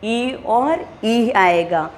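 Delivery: drawn out ई और ई; slow vowel demo. Shown as a demonstration. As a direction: stretch each ई slowly for the class. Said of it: "तालु से उच्चारण होने वाले स्वर".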